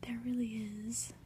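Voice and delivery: ordinary delivery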